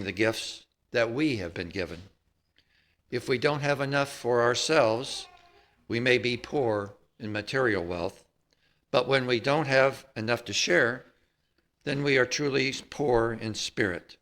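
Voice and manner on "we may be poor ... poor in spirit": usual speaking voice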